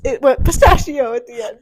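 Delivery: ordinary talk, nothing marked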